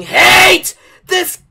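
screaming high in fury; real rage. Real, not acted. Streamer hating the game, real raging scream. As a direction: drag it out in a loud, raging scream. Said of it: "Ninety percent of this rage that you're hearing is real".

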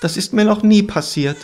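high-pitched lament; plaintive. A narrator's reading. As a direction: complain helplessly, pitched high. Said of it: "klagte der Läufer".

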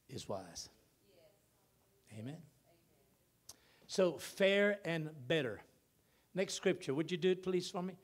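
usual speaking voice

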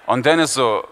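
ordinary delivery

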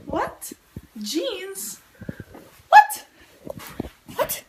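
high-pitched incredulous squeals; mock amazement. Vlogger announces a wardrobe breakthrough to viewers, mock amazement. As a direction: squeal it in exaggerated disbelief.

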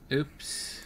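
whispered. Real, not acted. Thinks opponent rage quit.

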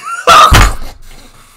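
high squealing burst of laughter; breathless high cackle of real laughter; wheezing laugh. Real, not acted. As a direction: lose it completely at the joke. Streamer cracking up at rumor.

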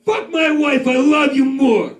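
high, yelled New York anger; hammed-up, drawn-out high-pitched yelling; angry New Yorker. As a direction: imitate a New Yorker roaring at a stranger. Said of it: "if they're angry, they give a shit".

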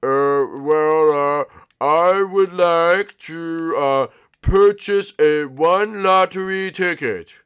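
slow, loud mock-adult formality; manly voice. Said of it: "put on my manly voice".

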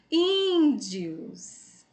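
índios with a long, high first syllable; stressed first syllable. Shown as a demonstration. Teacher showing pupils the strong syllable of índios, stressed first syllable. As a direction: stretch the ín of índios high, then fall. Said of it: "pronunciou com mais intensidade".

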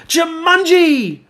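Judy shouting the game's name to end it; yelled. A narrator's reading. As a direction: yell it as loud as you can. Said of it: "she yelled as loud as she could".

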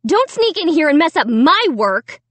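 high, indignant warning; snapping. A narrator's reading. Sister warns brother off her paintings, snapping. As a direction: pitch it up and punch the words. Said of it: "Sarah snapped".

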